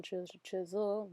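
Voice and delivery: normal talking voice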